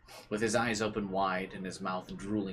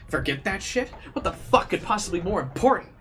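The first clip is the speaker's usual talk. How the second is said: rising, louder, higher furious shout; angry yelling; 10 semitones higher, 8 dB louder than usual. Acted. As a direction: scream it in outrage at him. Sister-obsessed character ranting.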